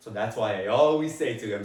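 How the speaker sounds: usual speaking voice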